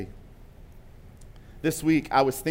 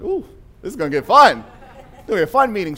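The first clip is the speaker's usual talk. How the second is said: higher and louder, thrilled at the coming clash; like someone about to enjoy a fight; gleeful anticipation; 6 semitones higher, 9 dB louder, faster than usual. Retold or imitated. Pastor retelling his own delight as the meeting turns tense, loud and high-pitched. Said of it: "And I was like".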